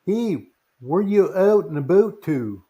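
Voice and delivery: slow, put-on Canadian accent. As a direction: drawl it out like a Canadian. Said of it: "That's the crappiest accent I ever heard".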